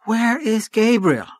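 high, drawn-out wail; despairing cry. A narrator's reading. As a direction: call out for him in exasperated despair. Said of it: "almost wringing her hands in despair".

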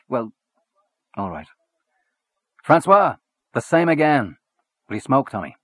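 lightly voiced swaggering Irish journalist; a hint of a hearty Gallagher. A narrator's reading.

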